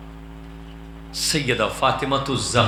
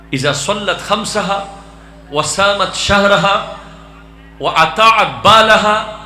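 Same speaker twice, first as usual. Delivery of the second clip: raised, loud recitation in measured phrases; forceful preaching; 6 semitones higher, 8 dB louder than usual. Real, not acted. Preacher recites the four deeds for women, forceful preaching. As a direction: ring out each phrase to the crowd.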